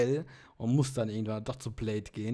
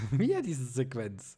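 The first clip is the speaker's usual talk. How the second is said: laughing, slower resigned "Wieder"; amused groan. Real, not acted. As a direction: laugh it off as the scene repeats. Cutscene replays a third time.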